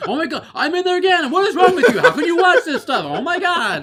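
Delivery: very high, loud shrieking rant; outraged son. Retold or imitated. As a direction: hysterical scandalized scolding. Son's tirade acted again.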